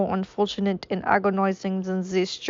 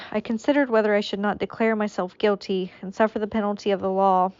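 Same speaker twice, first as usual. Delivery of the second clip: usual speaking voice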